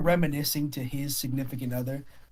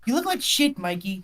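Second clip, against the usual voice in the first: angry scolding; 5 semitones higher than usual. Acted. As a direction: scold him like a furious big sister. GM voices angry woman scolding.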